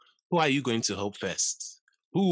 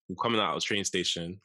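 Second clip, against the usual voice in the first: usual speaking voice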